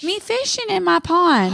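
put-on proud small child explaining; toddler voice. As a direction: toddler telling mom about his pond. Mom imitates toddler.

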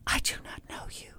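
hushed, breathy whisper of denial. Retold or imitated. Host imitating Amy denying she knows Bill, in a whisper. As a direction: whisper it flat and scared.

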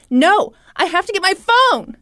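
mock frantic insistence, high and loud; freaking out. Woman coming back at father and son, freaking out. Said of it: "freaking out about her stolen cell phone".

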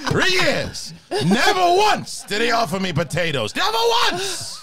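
mock-outraged movie star, repeating in a high shriek; Al Pacino. Actor protests never being offered potatoes, shouting Pacino impression. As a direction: build indignation to a very high last burst. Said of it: "Pacino just went from being like that really high voice".

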